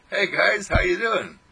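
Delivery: loud friendly greeting to arguing friends; cheery greeting. Pretend. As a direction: breeze in with a cheerful hi.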